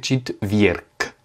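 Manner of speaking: четверг slowly, ending in a crisp K, chit-VYERK; final G as K. Shown as a demonstration. Teacher showing the rule, четверг slowly ending in K. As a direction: devoice the last letter of четверг to K. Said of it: "il se prononce comme un K".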